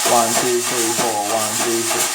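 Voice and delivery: quick, even count in time; up-tempo count. Shown as a demonstration. Drum teacher counting off the up-tempo pattern quickly over the beat. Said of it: "Up-tempo pattern might be something like this".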